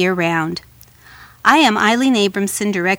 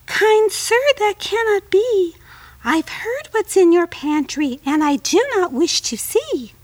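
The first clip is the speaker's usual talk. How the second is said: very high, frightened little fly; high tiny fly voice; 10 semitones higher than usual. Acted. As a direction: squeak it like a scared tiny insect.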